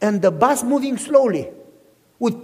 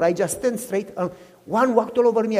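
normal talking voice

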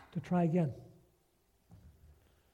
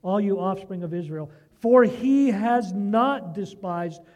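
preaching at full force; 7 dB louder than usual. Acted. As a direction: proclaim every verse like a sermon climax. Preaches psalm aloud at full force.

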